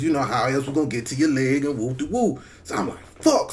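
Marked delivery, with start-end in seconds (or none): 3.2-3.5 frustrated curse. Retold or imitated. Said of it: "mad as a motherfucker".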